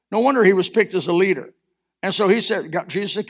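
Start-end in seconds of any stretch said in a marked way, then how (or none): none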